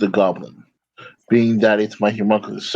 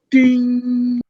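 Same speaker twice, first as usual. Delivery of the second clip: high bright ding like a bell; chime sound; 12 semitones higher than usual. Acted. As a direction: ring it out like a chime. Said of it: "an affirming chime".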